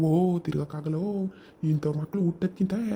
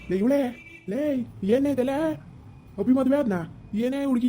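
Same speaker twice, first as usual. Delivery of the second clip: angry shouting; 5 semitones higher than usual. Acted. Mother-in-law yelling for her daughter, angry. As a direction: call out furious and shocked.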